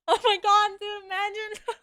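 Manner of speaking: high, loud, talking through helpless laughter; real laughing fit. Real, not acted. Streamer reacting to HR in chat, cracking up. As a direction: crack up and squeal the words out.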